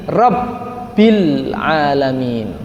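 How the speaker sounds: "Rabbil" slow with the "bb" held; doubled b. Shown as a demonstration. Teacher modelling the double b, slow chant. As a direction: chant "Rabbil Alamin" slowly. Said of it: "Di atasnya ba".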